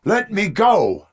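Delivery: high, loud, drawn-out yell; desperate shout. Acted. Officer demanding to be let go, high desperate shout.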